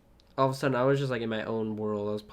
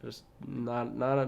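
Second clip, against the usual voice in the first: ordinary talk, nothing marked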